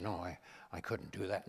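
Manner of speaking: imitating Reagan, low and soft; hushed, folksy Reagan impression; Ronald Reagan. Reagan confiding to the comedian about his impressions, low and soft. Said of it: "He said".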